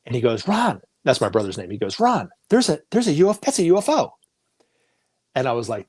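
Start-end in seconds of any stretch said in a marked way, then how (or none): 0.5-0.8 higher-pitched retold call; urgent call. Nephew's dad alerted to a UFO, urgent call. 2.0-4.1 high, stammered retold alarm; excited alert. Telling Ron about a UFO overhead, excited alert.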